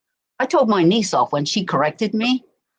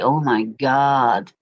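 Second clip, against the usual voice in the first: drawn-out, lower exasperated "Oh my God"; 8 semitones lower, much slower than usual. Retold or imitated. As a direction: groan it out slowly, fed up. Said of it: "I'll be like".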